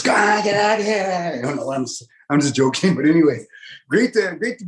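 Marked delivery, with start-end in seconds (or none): 0.0-1.3 a slightly raised, hammed-up shout; mock yell at kids. Comic parent mock-yelling at noisy kids, slightly raised. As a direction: bark it like a parent shooing kids. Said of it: "yelling at your kids".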